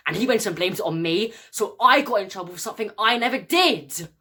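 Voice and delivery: high, loud burst of grievance; aggrieved outburst at unfair blame; indignant protest. Acted. Acted grievance about being blamed.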